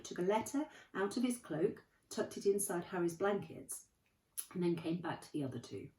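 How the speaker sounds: plain reading voice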